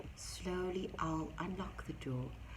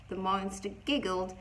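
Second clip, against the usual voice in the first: ordinary delivery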